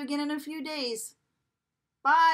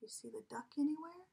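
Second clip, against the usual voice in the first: whisper; 3 semitones higher, 9 dB quieter, faster than usual. Acted. Secret hint to listening child.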